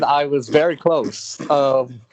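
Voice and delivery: ordinary delivery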